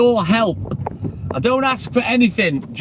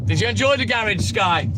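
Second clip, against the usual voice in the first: ordinary talk, nothing marked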